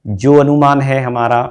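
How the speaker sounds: usual speaking voice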